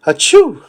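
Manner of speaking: a huge comic sneeze; giant sneeze. Acted.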